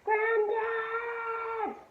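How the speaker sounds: high, drawn-out sung cry for Granddad; wailing call. A narrator's reading. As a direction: call him across the house. Sister calls out to grandfather.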